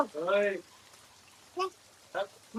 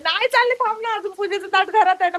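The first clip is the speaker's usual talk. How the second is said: put-on high, louder, quick baby talk; playful reassurance; 5 semitones higher, 4 dB louder, faster than usual. Mother telling her baby she won't leave, high and quick. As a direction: soothe the baby brightly and quickly.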